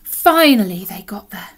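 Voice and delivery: plain reading voice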